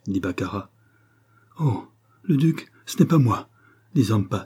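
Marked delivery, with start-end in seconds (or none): 1.6-3.5 high, panicky protest; frightened denial. A narrator's reading. Valet denying he poisoned the duke, frightened. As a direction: plead innocence in alarm.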